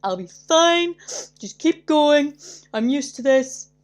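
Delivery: pretending to be fine while upset; brave tearful patient. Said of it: "I'm like".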